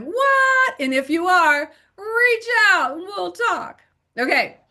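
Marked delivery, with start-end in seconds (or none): 0.0-0.7 high, loud, drawn-out "What?"; incredulous what. Retold or imitated. Teacher mimicking a baffled tutor watching, incredulous. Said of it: "you might be sitting there going".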